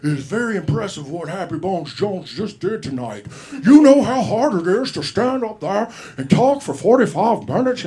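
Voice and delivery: put-on Nixon-like old man, building loud and high. Drunk comedian praising the opener in old Nixon-like voice. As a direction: praise him like an old politician. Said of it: "he's like".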